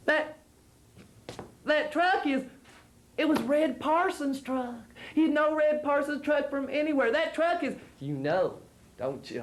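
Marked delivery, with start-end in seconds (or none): 0.1-7.7 put-on higher, urgent old man voice; excited old man. A narrator's reading. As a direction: blurt it out, worked up.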